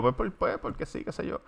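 soft, near-whispered stammer; shy mumble. Retold or imitated. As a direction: mumble it like a bashful kid.

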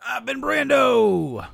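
high, loud, slow sign-off; announcer sign-off. Pretend. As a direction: stretch out the name like a host. Show sign-off, drawn out.

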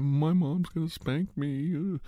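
high, slow, mock whimper; lightly mocking a bully who starts crying; whiny crybaby. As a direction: mimic a crybaby, high and drawn out.

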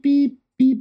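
sing-song machine beeping; imitated monitor beep. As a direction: mimic the oxygen alarm going off. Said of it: "I'm still getting beep".

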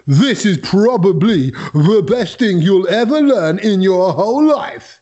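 slow, drawn-out hype voice; lightly voiced friend, building it up slowly; Kedge impression. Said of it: "that was my impression of Kedge".

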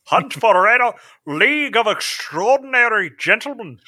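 high, hammed-up Scottish film star; Sean Connery. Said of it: "We're just doing Sean Connery movies".

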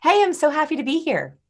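high-pitched, eager, upbeat hello; excited greeting. Shown as a demonstration. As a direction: greet them like you're thrilled to be here. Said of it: "just hear the difference".

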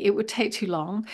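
ordinary talk, nothing marked

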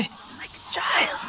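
hushed confiding whisper. Acted. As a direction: whisper it like a secret. Customer roleplay aside.

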